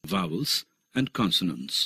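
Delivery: slow announcement. Shown as a demonstration. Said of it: "पहले vowels की ध्वनियों".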